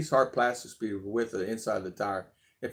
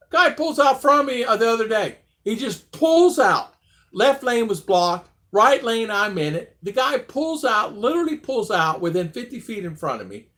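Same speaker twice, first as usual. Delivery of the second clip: agitated retelling; 8 semitones higher, 6 dB louder than usual. Retold or imitated. Retelling a near crash.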